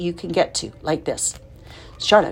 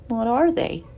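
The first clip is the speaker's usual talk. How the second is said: sadly; 7 semitones higher than usual. A narrator's reading. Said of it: "asked Wilbur sadly".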